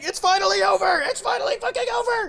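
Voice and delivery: high, loud relieved cry. Real, not acted. Streamer shouting relief at the game's end. Game ends.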